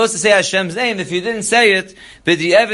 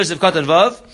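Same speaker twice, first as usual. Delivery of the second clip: normal talking voice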